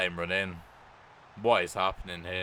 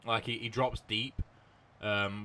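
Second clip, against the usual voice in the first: normal talking voice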